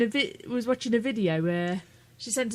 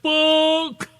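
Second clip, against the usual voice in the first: drawn-out, high, louder mock cry of dismay; mock-exasperated outburst, retold; retold exclamation; 6 semitones higher, 5 dB louder than usual. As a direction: exclaim it higher and louder, stretching the word. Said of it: "And I'm like".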